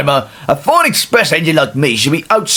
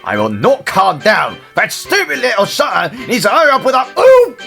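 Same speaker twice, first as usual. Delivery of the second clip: angry refusal; 6 semitones higher than usual. Acted. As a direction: refuse to calm down, getting more worked up. Told to calm down, refuses.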